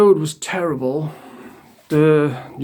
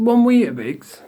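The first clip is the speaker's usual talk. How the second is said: high-pitched, indignant echo; incredulous; 7 semitones higher than usual. Retold or imitated. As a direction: query it in retold disbelief. Retold complaint about tiny breakfast.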